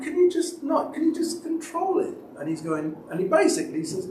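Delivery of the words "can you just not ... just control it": high, pleading request; exasperated plea. Retold or imitated. Pastor pleading with a swearing friend outside church, high and exasperated. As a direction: beg him to stop, half exasperated.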